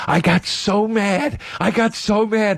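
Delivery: high, slow, seething imitation; imitating a friend getting worked up. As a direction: draw it out high, savouring the fantasy. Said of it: "he would get so worked up".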